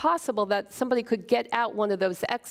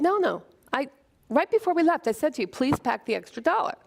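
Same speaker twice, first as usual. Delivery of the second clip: wife arguing with husband in the atrium, heated; arguing back; 5 semitones higher, faster than usual. Retold or imitated. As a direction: insist you're right, heated. Her side of the quarrel.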